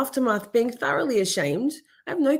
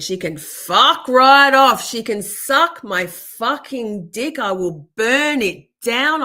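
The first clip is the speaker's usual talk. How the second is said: angry rant; 4 semitones higher, 7 dB louder, slower than usual. Real, not acted. Venting rage at the creator.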